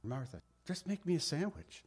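calm, softly spoken request; mock-gentle, hushed voice; Jesus. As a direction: keep it quiet and unruffled. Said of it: "he says it in a calm voice".

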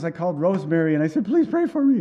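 high, hurried plea, climbing sharply; imitating someone overwhelmed. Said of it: "I'm just overwhelmed".

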